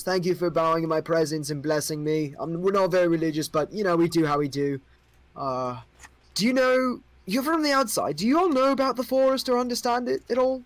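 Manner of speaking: a curious king asking outsiders; young king. Acted. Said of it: "like, goes".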